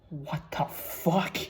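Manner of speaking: slow, drawn-out stunned curse; stunned disbelief. Real, not acted. Horrified by what the bot just wrote, slow stunned curse. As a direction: let it hang in disbelief.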